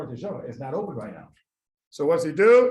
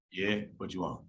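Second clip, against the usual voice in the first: doorman; 10 semitones lower, 8 dB quieter than usual. Acted. Doorman answers through top door.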